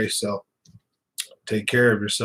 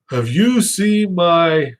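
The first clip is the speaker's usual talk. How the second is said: higher, slow put-on voice asking the wife; drawn-out, higher mock question; retold question; 5 semitones higher, 5 dB louder, much slower than usual. To his wife, slow higher put-on voice. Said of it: "and I go".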